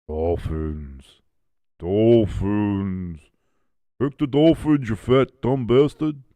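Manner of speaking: put-on the universe speaking in a New York accent. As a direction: intone it like a cosmic New Yorker, then get insulting. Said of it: "universe has a bit of a New York accent".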